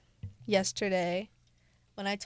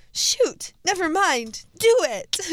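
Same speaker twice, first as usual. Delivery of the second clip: higher, louder acted-out text; punchy mock urgency, pitched up; dad's excited reply; 7 semitones higher, 6 dB louder, much slower than usual. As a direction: read Dad's text with sudden eagerness. Acting out dad's texted reply.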